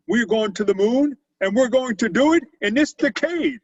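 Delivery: loud, high presidential speech voice; John F. Kennedy. Retold or imitated. President announcing the moon mission to the nation, loud and high. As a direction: proclaim it like a president from a podium. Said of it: "he said those exact words".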